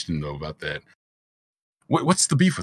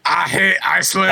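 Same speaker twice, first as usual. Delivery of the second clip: put-on Icelandic accent; 5 semitones higher, 3 dB louder than usual. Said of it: "what about Icelandic Jack?".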